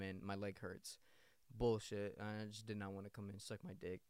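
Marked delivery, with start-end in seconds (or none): none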